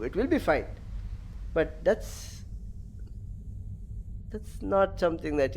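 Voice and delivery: usual speaking voice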